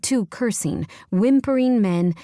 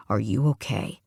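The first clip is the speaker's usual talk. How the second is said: deeper male voice; 7 semitones lower than usual. A narrator's reading. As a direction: voice the hero, low and caring. Weston's line voiced by narrator.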